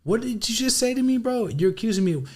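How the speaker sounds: high-pitched outraged retort, mock confrontation; imagined furious reply to a shady interviewer; indignant comeback. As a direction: fire it back at the interviewer, voice way up in disbelief.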